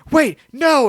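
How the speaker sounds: high, loud panicked cry. Retold or imitated. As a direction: yelp in cartoon panic. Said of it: "He's like".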